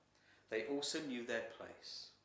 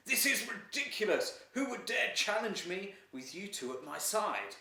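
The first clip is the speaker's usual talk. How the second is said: loud, high outraged roar; furious emperor; 8 semitones higher, 8 dB louder than usual. Acted. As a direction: hurl the words out in royal fury. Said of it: "smashed his fists down on the table".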